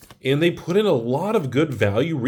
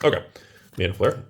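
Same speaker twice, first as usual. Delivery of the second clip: ordinary talk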